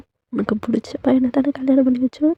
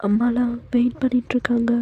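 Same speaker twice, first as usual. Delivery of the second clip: ordinary delivery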